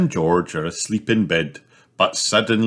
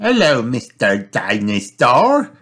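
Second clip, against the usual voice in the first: put-on higher, louder happy girl; Peppa's greeting; 5 semitones higher, 4 dB louder than usual. A narrator's reading. Sister welcoming the mended toy, happy high greeting. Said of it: "says Peppa".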